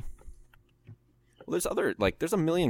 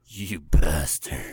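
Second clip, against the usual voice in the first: very slow, low mock scorn; mock outrage; 5 semitones lower, 4 dB louder, much slower than usual. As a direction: drag it out in fake disgust.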